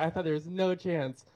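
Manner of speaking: ordinary talk, nothing marked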